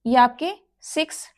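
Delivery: ordinary delivery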